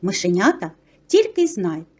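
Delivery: ordinary delivery, nothing marked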